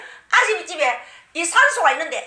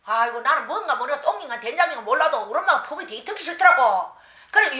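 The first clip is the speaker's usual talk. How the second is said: ordinary talk, nothing marked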